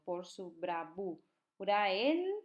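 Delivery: "bravura el" drawn out very slowly with "el" rising; slow syllable reading. Shown as a demonstration. Teacher showing students the synalepha slowly. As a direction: stretch out "Por su bravura el" to show the vowels joining. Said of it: "juntas porque termina en vocal y empieza en vocal".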